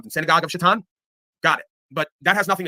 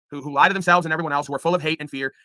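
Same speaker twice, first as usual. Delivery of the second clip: ordinary delivery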